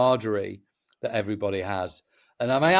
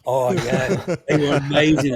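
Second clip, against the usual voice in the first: mock gushing fan voice, laughing through it; clueless festivalgoer; 3 semitones higher, 5 dB louder than usual. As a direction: play a fan who never heard of the band. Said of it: "And they go".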